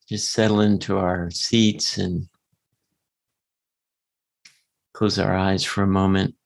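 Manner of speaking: guided meditation voice. Acted.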